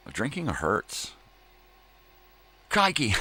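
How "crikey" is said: mock Australian.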